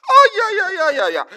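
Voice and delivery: high, loud cry of joy; joyful shout. Real, not acted. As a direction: cry out in delight. Rejoicing exclamation.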